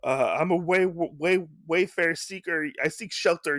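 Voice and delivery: high-pitched call for shelter; pleading traveler. Retold or imitated. Soaked wayfarer begging at a hut door, high pleading call. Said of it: "he's like".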